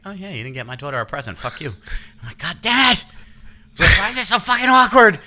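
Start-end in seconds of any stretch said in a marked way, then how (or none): 0.1-1.8 much softer, quick muttered jab; snide mutter. Retold or imitated. Other parent silently judging the dad, soft and snide. 2.4-5.2 high, exasperated complaint; mortified groan. Retold or imitated. Dad mortified at school drop-off, high and exasperated.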